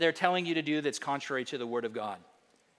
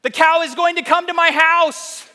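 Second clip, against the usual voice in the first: very high, loud, absurd insistence; hammed-up high-pitched declaration; mock objector; 12 semitones higher, 10 dB louder than usual. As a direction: push the silly comeback to the top of your voice. Absurd objector's comeback, mocked.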